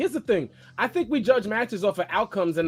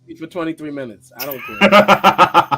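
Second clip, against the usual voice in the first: laughing shout; 7 semitones higher, 9 dB louder than usual. Real, not acted. Laughing, shouting over the bruv chant.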